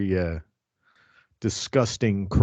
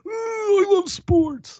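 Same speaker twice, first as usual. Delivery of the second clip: very high, silly falsetto cheer; hammed-up high-pitched fan voice; mock sports fans; much slower than usual. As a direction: squeal it in a mocking high voice. Mocking the male sports fans.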